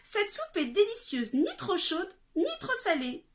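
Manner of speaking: put-on high delighted little-girl voice; lightly voiced girl enjoying soup; Goldilocks relishing soup. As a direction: savour each word like a hungry child. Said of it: "se dit-elle en la mangeant".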